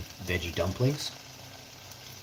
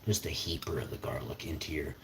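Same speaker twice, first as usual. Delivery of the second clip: whispering; 6 semitones lower, 7 dB quieter than usual. Real, not acted. Quiet aside while cooking.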